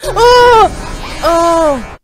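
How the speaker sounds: loud, high yelps of fright; startled cries. Real, not acted. Viewer jolted by the video, startled yelps. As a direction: cry out as if jumped at.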